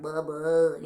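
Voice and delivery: low cat character voice; lightly voiced low cat; Smokey. Showing how the original cat talked, low character voice. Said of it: "he talked like this".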